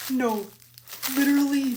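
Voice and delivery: loud, shocked protest; real burst of dismay. Real, not acted. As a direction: cry out in disbelief at the damage. Discovers damage right after declaring safety.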